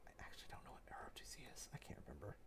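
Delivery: very soft, quick mumble to oneself; barely audible admission, under the breath; whispered aside. Real, not acted. Muttered aside to self.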